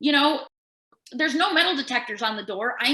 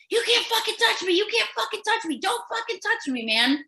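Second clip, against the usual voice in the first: screamed retort; 11 semitones higher than usual. Retold or imitated. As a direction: shriek it at the guards. Retold patient screaming at guards.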